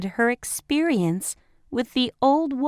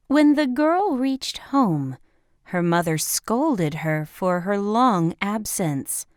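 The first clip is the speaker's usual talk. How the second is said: ordinary delivery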